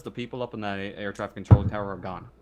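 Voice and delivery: ordinary delivery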